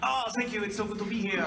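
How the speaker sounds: high, loud, gushing fake French accent; hammed-up French-accented English. Replying to the handler by mistake, high hammed-up French accent. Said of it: "speaking to her in a French accent".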